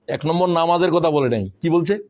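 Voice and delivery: ordinary talk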